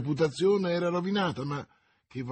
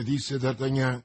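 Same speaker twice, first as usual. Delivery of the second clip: usual speaking voice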